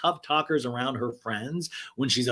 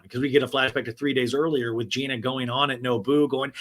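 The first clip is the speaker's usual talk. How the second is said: ordinary delivery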